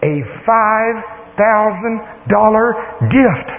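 high voice, breaking the news piece by piece; excited daughter. Retold or imitated. Daughter announces a big gift to dad, excited. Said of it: "She's all excited".

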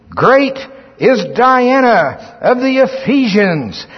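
high, drawn-out crowd chant; crying out. Acted. Preacher voicing the wrathful Ephesians, crying out. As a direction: proclaim it like an angry crowd, slowly. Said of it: "and cried out".

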